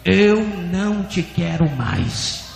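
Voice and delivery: weighty divine rejection with a strong attack; stronger voice of God. Retold or imitated. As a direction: drive the verdict home, words stretched. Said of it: "a voz repetiu mais forte".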